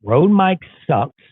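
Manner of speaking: slow and loud, every word hit; loud, slow declaration. Shown as a demonstration. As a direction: call it out slowly and loudly. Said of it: "I need to say".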